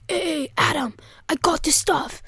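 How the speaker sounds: stretched-out "I got the stuff"; conspiratorial call. Retold or imitated. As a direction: imitate a friend announcing his haul on the sly. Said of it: "that sounds wrong".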